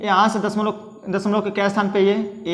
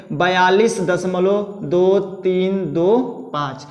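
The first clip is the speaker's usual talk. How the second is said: digit by digit; much slower than usual. Shown as a demonstration. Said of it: "हम कैसे पढ़ेंगे?".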